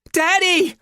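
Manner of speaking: put-on very high, loud child's cry. A narrator's reading. Small girl losing her grip on deck, crying out. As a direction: shriek for your father. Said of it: "Lana cries out".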